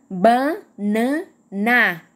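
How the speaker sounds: "Banana" slowly split as ba, na, na; three drawn-out beats in "Banana"; syllable by syllable. Shown as a demonstration. Teacher counting three times, "Banana" said in separated syllables. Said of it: "Três vezes".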